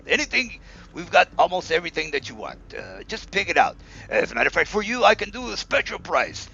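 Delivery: lightly voiced salesy drow merchant, rising on the offers; pushy merchant. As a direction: sweet-talk a customer into a deal, pitch jumping on the bargains.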